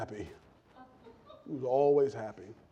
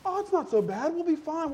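high, breezy, carefree voice; cheery apprentice; 12 semitones higher than usual. Retold or imitated. Happy apprentice to his cursing boss, high and cheery. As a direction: chirp reassurance without a worry.

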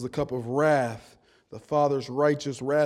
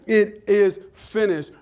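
loud, slow proclamation, higher pitched; crying out; 5 semitones higher, 9 dB louder, slower than usual. Retold or imitated. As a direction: cry it out word by weighty word. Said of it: "when he had cried again".

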